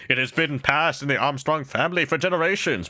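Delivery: a hint of a proud bodybuilder; lightly hammed heroic declaration; Armstrong impression. Streamer quoting Armstrong lightly, proud and grand. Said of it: "Armstrong from Full Metal Alchemist".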